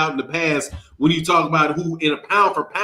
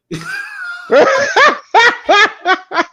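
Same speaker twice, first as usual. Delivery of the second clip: very high, loud burst of laughter; high cackle; 5 dB louder than usual. Real, not acted. Co-host admits he can't justify Bud, high cackling laugh. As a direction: cackle at his own joke.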